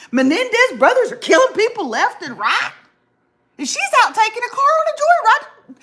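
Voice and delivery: high, loud ranting over the eighties; mock outraged rant. Host ranting to friend about childhood dangers, mock outraged. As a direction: whip up fake outrage, pitch climbing.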